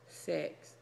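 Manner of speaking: low whispered man's voice; man voice in the back. Pretend. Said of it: "that man voice in the back say six".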